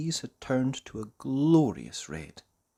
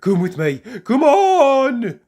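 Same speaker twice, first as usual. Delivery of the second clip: loud, rising to a high urgent call; urgent cry; 12 semitones higher, 13 dB louder, slower than usual. Acted. Mister Forgetful urging the farmer to follow, a loud high cry. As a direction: hurry him along. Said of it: "cried Mister Forgetful".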